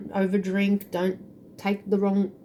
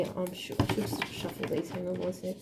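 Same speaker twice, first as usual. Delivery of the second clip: very soft, half-whispered to herself; barely voiced thinking aloud; muttered aside; 9 dB quieter than usual. Real, not acted. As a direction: drop to a murmur while busy with something. Aside to herself while shuffling cards.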